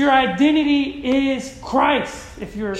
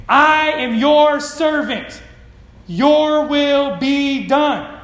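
loud, slow, emphatic surrender to God; preacher's ringing, drawn-out declaration; forceful declaration; 2 semitones higher, 6 dB louder, much slower than usual. Acted. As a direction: proclaim it slowly, leaning on each word. Preacher voices Mary's surrender.

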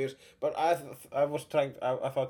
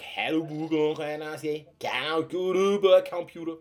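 put-on Danish accent; 3 dB louder than usual. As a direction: garble it like exaggerated Danish. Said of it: "then there's Danish".

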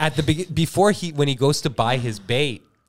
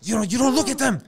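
stern terrorist leader; 10 semitones higher, faster than usual. Acted. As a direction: scold your men, pitched up. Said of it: "I'm like".

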